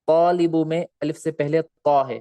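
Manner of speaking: ordinary talk, nothing marked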